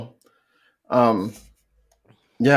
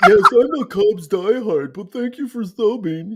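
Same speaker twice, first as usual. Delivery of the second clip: weird dude voice; 6 semitones higher than usual. Retold or imitated. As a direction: read it as an odd dude. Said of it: "this weird dude says".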